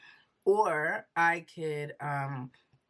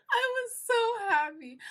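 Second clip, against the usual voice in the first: high, teary laughing delight; laughing through joy. Real, not acted. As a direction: laugh it out, overwhelmed with happiness. Recalling joy, laughing crying.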